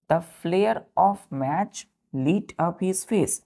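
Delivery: slow, deliberate sentence reading; measured, evenly spaced words; slow read-back. Shown as a demonstration. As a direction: read it back slowly for the class.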